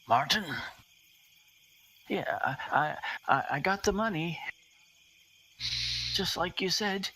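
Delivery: a man answering through a door; muffled voice behind a door. Acted. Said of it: "a muffled voice on the other side of the door say".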